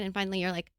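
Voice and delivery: ordinary talk, nothing marked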